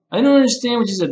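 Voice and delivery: curt irritated teacher, clipped and quick; blunt, annoyed teacher. Shown as a demonstration. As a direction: snap it back impatiently, stressing DON'T. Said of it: "The face changes".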